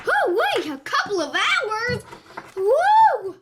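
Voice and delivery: high, loud burst of disbelief; exaggerated surprise. Child told of the long wait, high surprised outburst. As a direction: exclaim it high, ending in a whoop.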